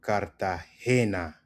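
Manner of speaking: "Cartagena" slowly as kar-ta-HE-na; emphasis on HE. Shown as a demonstration. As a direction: lean on HE in "Cartagena". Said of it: "Emphasis on the he".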